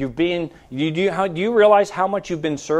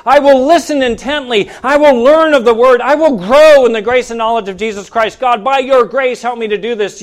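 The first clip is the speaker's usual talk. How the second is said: raised, higher declaration in a driving rhythm; full-force preaching; 5 semitones higher, 6 dB louder than usual. Real, not acted. Preacher voicing a worshipper's vows to God, fervent preaching. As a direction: proclaim each vow to the congregation.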